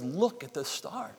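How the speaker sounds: soft and tender; a kind father pointing upward; gentle. Acted. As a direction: hush it, full of wonder. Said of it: "He's gentle and merciful".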